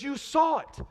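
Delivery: high-pitched insistent exclamation; acted burst of vindication; excited accusation. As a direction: press it home excitedly. Ray excitedly confronts him again.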